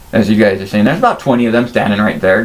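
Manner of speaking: ordinary delivery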